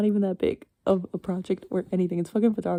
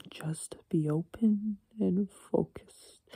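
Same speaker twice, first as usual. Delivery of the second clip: whispered self pep talk; 3 dB quieter, much slower than usual. Retold or imitated. Self pep talk while driving.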